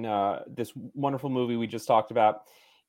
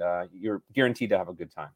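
ordinary delivery

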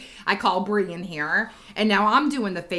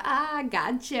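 higher, sing-song mock gloat; teasing gotcha; 4 semitones higher, 6 dB quieter than usual. Mom catching Bree with chicken butt, teasing. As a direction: crow lightly over a landed prank. Gloating after the prank lands.

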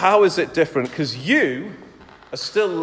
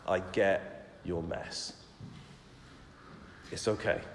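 gentle reassurance; 6 semitones lower, 9 dB quieter than usual. Retold or imitated. Jesus comforting someone in their mess, soft and gentle. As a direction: comfort a hurting friend softly. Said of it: "alongside you and say".

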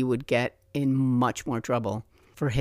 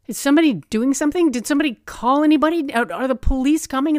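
high, loud and fast, urgent questions; frantic demand; 12 semitones higher, 8 dB louder, much faster than usual. Retold or imitated. Narrator urging the people standing around to act, frantic. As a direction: fire off panicked questions at bystanders. Urgently asking bystanders for help.

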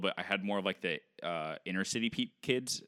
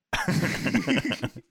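high burst of laughter; real high laugh; 10 semitones higher, 3 dB louder than usual. Real, not acted. Laughing at being called American.

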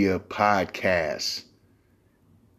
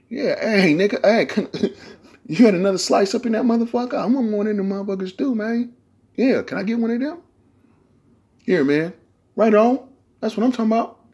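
high begging voice; 11 semitones higher than usual. Retold or imitated. Acting begging for pizza slice.

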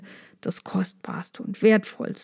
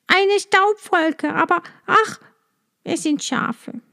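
sister's answer; 8 semitones higher than usual. A narrator's reading. Sister reporting from the tower, high and disappointed. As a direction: sigh out the false hope from above. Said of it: "antwortete die Schwester".

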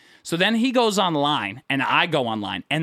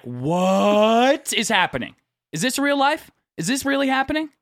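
mock disbelief, pitched up; stunned disbelief; 6 semitones higher than usual. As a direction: play up the bewilderment of a baffled onlooker. His stunned reaction in the store.

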